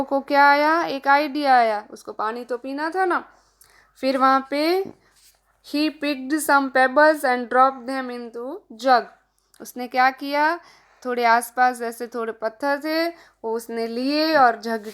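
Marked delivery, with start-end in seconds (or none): 5.7-9.0 very slow, word-by-word English reading. Shown as a demonstration. Teacher reads the worksheet sentence to children, very slow and deliberate. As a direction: spell it out slowly for the kids.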